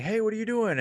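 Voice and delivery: high, sing-out retold hail; calling out. Retold call to stranger.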